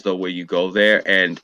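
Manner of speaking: ordinary talk, nothing marked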